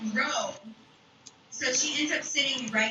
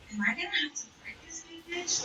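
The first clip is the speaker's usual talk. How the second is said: high, hushed mock-scared question; retold dread; 7 semitones higher, 5 dB quieter than usual. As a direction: relive a scared inner thought, softly. Scared thought before a fight.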